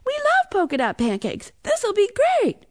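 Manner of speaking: put-on high, gleeful kid voice; thrilled little brother winning someone over; excited. A narrator's reading. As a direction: gush with delight, pitched high. Noah delighted with his wish.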